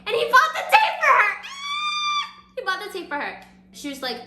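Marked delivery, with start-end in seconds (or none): 0.1-2.4 very high, loud fangirl shriek; real gushing outburst, squealing; excited squeal. Real, not acted. Storyteller gushes over romantic moment. 2.5-3.4 high-pitched gush at normal volume; excited but reined in; subdued squeal. Real, not acted. Repeats it after the squeal.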